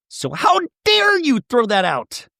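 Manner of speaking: high, hammed-up indignation; high affronted outburst, played for laughs; mock outrage. Imitating tearful Shannon's outrage.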